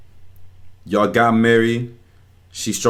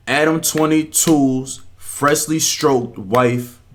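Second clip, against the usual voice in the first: slow, raised-pitch billing of the guest; drawn-out announcement; 6 semitones higher, much slower than usual. Real, not acted. Reactor announcing whose sit-down he is watching, drawn-out announcement. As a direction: draw out each word like an introduction. Announces the video's subject for effect.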